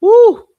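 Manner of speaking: very loud, high "woo"; high whoop. Real, not acted. Vlogger reacting to the audit, high whoop. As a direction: let a falsetto hoot burst out.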